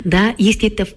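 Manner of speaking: usual speaking voice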